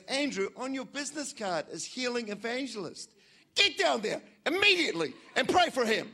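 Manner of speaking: a wife's firm nudge, rising to loud command; Janine's voice. Retold or imitated. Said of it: "She goes".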